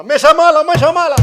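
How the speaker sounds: hammed-up granny telling off the table; grandma scolding table. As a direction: scold the naughty table for the child. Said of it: "Y dice la abuela".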